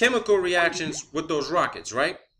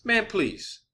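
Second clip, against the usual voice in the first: higher-pitched, worked-up disbelief; incredulous ranting; 6 semitones higher than usual. Real, not acted. Skeptic challenging the space station's altitude drop, incredulous. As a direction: scoff in a raised pitch, openly disbelieving.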